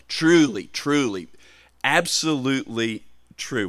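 each "Truly" punched high and loud, set apart; emphatic. Retold or imitated. Preacher quoting Jesus to underline a point, emphatic. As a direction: hammer "Truly, truly" as Jesus' verbal highlighter. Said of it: "he is giving emphasis to a point".

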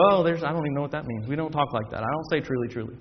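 low, soft, uninterested mumble; imitating an unimpressed churchgoer; sleepy dismissal. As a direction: shrug it off as a bored listener. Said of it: "it puts us to sleep".

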